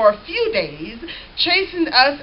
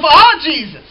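crying out; 7 dB louder than usual. Retold or imitated. Crumbling believers cry out.

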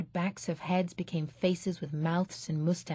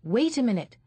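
sharply; 5 semitones higher, 5 dB louder than usual. Acted. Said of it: "said sharply".

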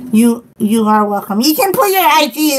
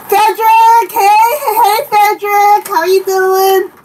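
high, loud singsong hello; excited greeting; 7 semitones higher, 3 dB louder than usual. Real, not acted. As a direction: call out happily to a newcomer. Calls greeting to arriving viewer.

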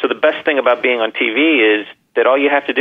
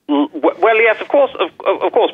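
higher pitched put-on British, stumbling; mock British accent; 10 semitones higher than usual. Shown as a demonstration. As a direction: slip into a posh-ish British voice. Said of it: "that was a great way to say yes".

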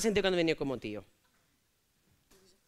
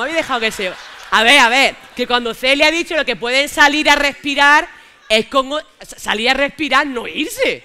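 exasperated protest; 4 semitones higher, 10 dB louder than usual. Real, not acted. Scolding those who let them leave.

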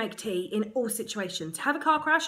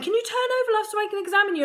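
high, sing-song midwife, louder; 11 semitones higher, 5 dB louder, much faster than usual. Retold or imitated. Midwife asking labouring mother to turn over, high sing-song. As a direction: coax like a chirpy midwife. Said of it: "And she's saying".

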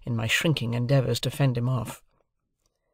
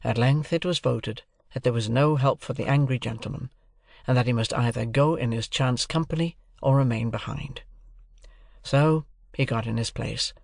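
ordinary delivery

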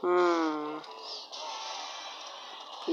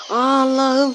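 startled oh no; 6 semitones higher, 4 dB louder, slower than usual. Real, not acted. Misplaced card by accident.

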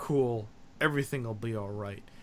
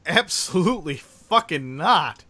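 emphatic refusal; 3 semitones higher, 7 dB louder, slower than usual. Retold or imitated. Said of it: "turned to Toon and said".

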